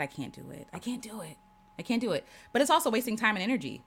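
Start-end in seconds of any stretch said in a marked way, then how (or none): 0.0-2.3 small, soft inner voice; quiet mock defeat; acted self-doubt. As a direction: mutter it to yourself as if giving up. Acting out her self-doubting inner voice.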